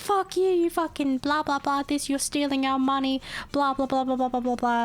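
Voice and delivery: high-pitched imitation of a ranting customer; mock abusive caller. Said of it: "just calling to be like".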